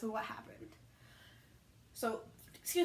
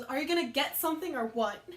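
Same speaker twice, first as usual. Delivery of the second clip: louder, punchy retold last attempt; exasperated demand; 2 semitones higher, 6 dB louder than usual. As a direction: press the customer for an answer. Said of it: "And then finally, the last one goes".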